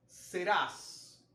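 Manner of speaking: "serás" drawn out slowly, weight on "rás"; stress on last syllable. Shown as a demonstration. Teacher offering another example of an aguda word, stressing the end of "serás". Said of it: "Es una palabra aguda porque la última".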